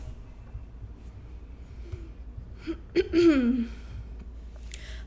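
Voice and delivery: long rough throat clear. Real, not acted. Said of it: "I'm losing my voice again".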